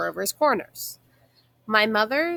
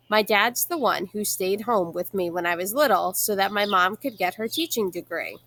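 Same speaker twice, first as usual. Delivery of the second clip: usual speaking voice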